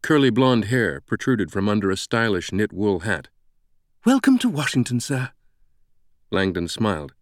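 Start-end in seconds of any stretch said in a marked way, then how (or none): 4.0-5.3 high, over-eager British woman's voice; exuberant British greeting. A narrator's reading. British greeter welcoming Langdon to Washington, exuberant and high. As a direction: welcome them with almost unsettling exuberance. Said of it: "spoke with an exuberance that was almost unsettling".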